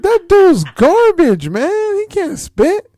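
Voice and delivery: high, loud mock outrage; indignant. Rapper dismissing [name] to coworker, indignant.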